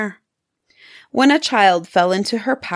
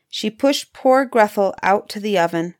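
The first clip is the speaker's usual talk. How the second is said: usual speaking voice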